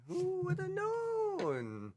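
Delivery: sarcastic. Acted. Sarcastic aside by game master.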